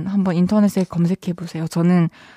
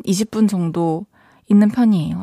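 ordinary talk, nothing marked

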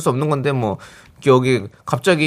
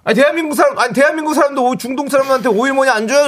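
indignant; 10 semitones higher than usual. Acted. Host's exaggerated indignant outburst.

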